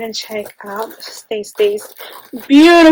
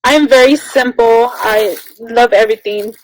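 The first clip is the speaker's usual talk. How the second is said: ordinary talk, nothing marked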